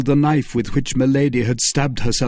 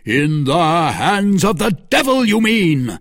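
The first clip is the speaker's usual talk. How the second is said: loud, high indignant cry; heated retort just short of shouting; raised voice; 7 semitones higher, 5 dB louder than usual. A narrator's reading. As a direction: raise your voice to be overheard. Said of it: "raising his voice so as to attract the notice".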